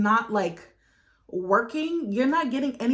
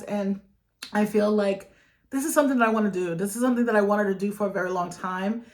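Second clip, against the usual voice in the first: usual speaking voice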